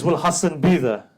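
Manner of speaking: daughter's urgent question. Acted.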